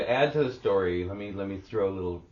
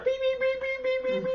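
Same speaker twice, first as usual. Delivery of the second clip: high piercing beep beep beep; car beep imitation. Parking lot full of car fobs chirping, high beeps. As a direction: chirp like a car remote finding itself. Crowd beeping their fobs.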